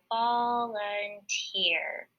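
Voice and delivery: slow, stretched-out volunteer, syllable by syllable; blending syllables slowly. Shown as a demonstration. Teacher blends the word for class, slowly. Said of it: "when I put those three syllables together".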